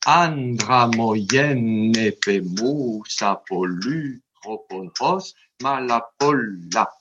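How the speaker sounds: "Andra moyen nepe moussa" very slow, long vowels drawn out, short ones brief; long vowel quantities. Shown as a demonstration. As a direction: stretch the long syllables of "Andra moyen nepe moussa" in dactylic metre, French sounds kept. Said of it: "si je mets les durées des voyelles". (« Andra moyen nepe moussa » très lent, voyelles longues étirées, à la française)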